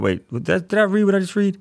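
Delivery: a playful, high, disbelieving double-take; mock double-take. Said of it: "Sometimes we can read stuff and be like".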